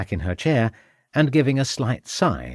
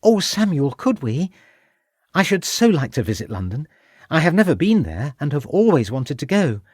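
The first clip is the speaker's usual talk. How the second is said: put-on eager, higher young wife's voice, hoping he agrees; lightly voiced young wife; 6 semitones higher, 3 dB louder than usual. A narrator's reading. Lucy asking her husband to take her to London, eager higher voice. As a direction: coax him like a hopeful young wife.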